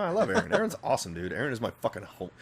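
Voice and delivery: usual speaking voice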